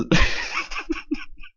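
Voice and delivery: very high, squealing laughing fit; real helpless laughter. Real, not acted. Host breaking up during the sign-off, helpless laughter. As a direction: burst out laughing mid-sentence. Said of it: "I'm out of breath from laughing".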